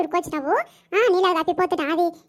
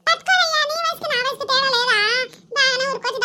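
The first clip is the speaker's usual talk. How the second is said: shout; 7 semitones higher than usual. Acted. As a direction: yell at him to hold it. Quarrel over water pots.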